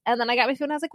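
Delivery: ordinary talk, nothing marked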